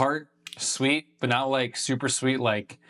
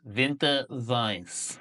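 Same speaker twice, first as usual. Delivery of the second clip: "Winter Weiss" said slow as "Vinter Vice", hard German V; drawn-out fake German "Winter Weiss"; slow hammed-up German pronunciation; mock German; much slower than usual. Shown as a demonstration. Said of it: "re-said with German sounds".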